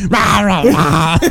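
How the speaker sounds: loud, garbled shouting; shouted wordless roar; imitating noise. Friend imitates how the misheard scream sounded, roaring. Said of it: "I thought it was just like".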